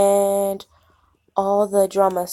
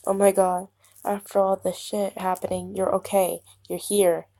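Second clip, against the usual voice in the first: usual speaking voice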